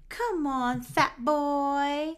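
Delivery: loud, high mock coach's jeer; drawn-out teasing call; goading taunt. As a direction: goad them on loudly, playfully. Monica goading Chandler.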